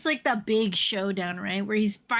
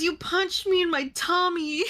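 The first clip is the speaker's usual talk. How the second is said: high, whining hurt voice; whiny villain; 8 semitones higher than usual. Retold or imitated. Villain whining to Liam Neeson after a punch, high and babyish. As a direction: whimper it like a big baby. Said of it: "He goes".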